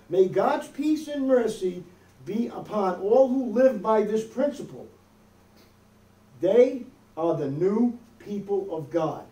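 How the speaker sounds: ordinary talk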